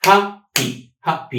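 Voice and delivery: ሃፒ broken slowly into two beats; slow, syllable-by-syllable ሃፒ; split into syllables. Shown as a demonstration. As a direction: say ሃፒ as two separate syllables, hap, pee. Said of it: "ክልተ፣ ቫወል ሳውንድስ ኣለዎ".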